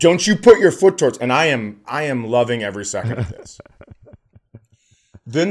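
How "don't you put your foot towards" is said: high, loud, rapid mom's warning; mock outraged scolding. Mom repeats her warning to the boy, mock outraged scolding. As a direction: imitate a mother escalating as she tells off her kid.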